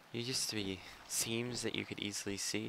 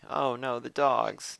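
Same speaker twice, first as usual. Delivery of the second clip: higher, louder cry of alarm; 4 semitones higher, 7 dB louder, slower than usual. Real, not acted. Gamer spotting attack dogs, alarmed. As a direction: call out in real dismay.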